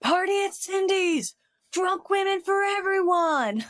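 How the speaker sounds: high, loud party yell; screaming. Retold or imitated. Shorty announcing a party to everyone, a high loud yell. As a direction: holler it to the whole cafeteria. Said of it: "Shorty then screams".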